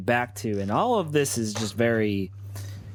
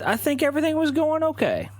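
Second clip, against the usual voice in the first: pitched way up, forced brightness; high nervous. Acted. Nervous man assuring the game master all was okay, voice pitched up. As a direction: push the words up high to sound fine. Character insisting life was fine.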